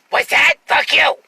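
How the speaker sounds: hammed-up rude guy; rude jerk voice. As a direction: bark it like a belligerent stranger. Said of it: "In that voice, though".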